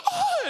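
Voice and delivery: very high gasping "ah"; exaggerated gasp into a high cry; mock moan. Comedian being felt by security, high mock moan. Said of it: "they be feeling them. I'm like".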